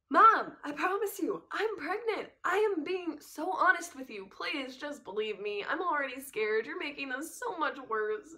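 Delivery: upset pleading, high and loud at first; worked up. Retold or imitated. Teen begging mom to believe she's pregnant, worked-up high plea. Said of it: "how worked up I was getting and how upset I really was".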